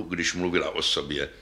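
usual speaking voice

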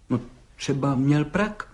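tentative guess, drawn out with hesitation; hesitant objection. A narrator's reading. Judge offering the policeman a guess, hesitantly. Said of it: "namítl soudce nejistě".

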